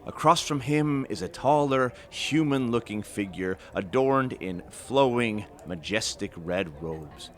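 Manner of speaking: story narration. A narrator's reading. Narrator opening the adventure in the tavern, storytelling voice. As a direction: draw listeners into the tale, unhurried.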